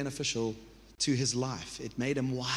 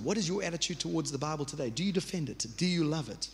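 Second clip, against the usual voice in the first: usual speaking voice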